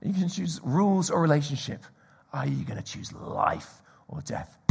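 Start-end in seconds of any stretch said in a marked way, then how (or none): 2.3-4.6 soft, lower, near whisper; whispered appeal. Real, not acted. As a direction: lean in and ask gently. Final hushed appeal.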